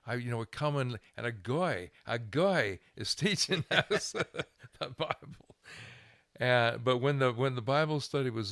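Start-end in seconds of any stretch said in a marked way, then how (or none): 2.1-5.6 laughing. Real, not acted. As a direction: laugh as you say it, amused.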